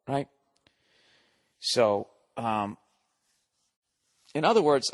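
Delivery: ordinary talk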